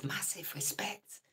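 slow breathy hushed whisper. Real, not acted. Greeting a viewer while his wife sleeps, whispering. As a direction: keep it down, someone's asleep next door. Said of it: "Shh, the missus is in bed".

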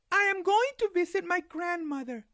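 put-on high girl's voice; little girl voice.

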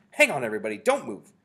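loud, urgent halt; crying out. A narrator's reading. Mildred telling the witches not to move, cried out. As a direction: call out urgently to freeze everyone. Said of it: "cried the witch, called Mildred".

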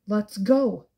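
loud, curt barked command; barked order. Acted. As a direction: bark it to get them moving. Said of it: "Kenji barks".

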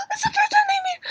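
high, crying squeal of delight; excited squeal. Real, not acted. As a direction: squeal it like a fan who just saw the kiss. Fan squealing over first kiss.

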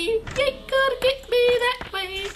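very high mocking taunt. Real, not acted. Taunting again, higher.

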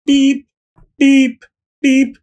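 high, loud mock beeps; alarm beeps. GM mimicking the stadium alarm, high beeps. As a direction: beep like an alarm going off.

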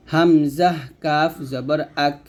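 Hamza কাফ জবর আক with air escaping on the final ক; breathy final k. Shown as a demonstration. Teacher demonstrating hams on kaf. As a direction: let breath out on আক. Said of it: "তো এরকমভাবে উচ্চারণ করতে হবে".

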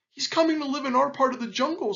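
fast, high-pitched alarmed voice; panicky peccary. A narrator's reading. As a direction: blurt out the frightening news. Panicked peccary passes on the news.

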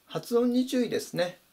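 usual speaking voice

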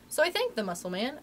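ordinary delivery, nothing marked

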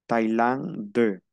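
TAILANDIA said French-style, slowly, "ta-i-lan... de"; French pronunciation demo. Shown as a demonstration. Teacher showing D is heard and final E not, French pronunciation demo. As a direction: split off a clear D ending in TAILANDIA. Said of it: "Pronuncio la D".

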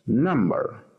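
number with the stress on "num"; number, first syllable stressed for learners. Shown as a demonstration. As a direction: lean on the start of number. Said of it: "Primera sílaba. Es la sílaba estresada".

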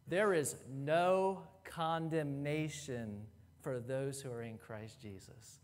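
tender reading. Real, not acted. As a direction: let the verse sink in gently. Savouring the verse slowly.

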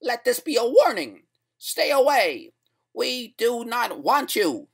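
high-pitched, loud voice from beyond; loud, high threat, drawn out at the end; menacing mystery voice. A narrator's reading. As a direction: deliver a slow, high warning. Threatening voice out of the loudspeaker.

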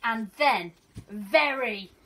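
teacher reading to her class, gentle and expressive; storybook reading. Acted. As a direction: read it like a teacher sharing a picture book with her class. Reads picture book to pupils.

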